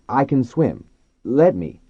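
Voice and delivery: asking for room. A narrator's reading. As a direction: insist to the crowding dolphins, voice up. Man asking dolphins for room.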